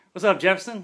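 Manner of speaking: loud, high call to the doorway. Retold or imitated. K nine calling out to Jefferson at the door, loud and high. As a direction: call out sharply across the room.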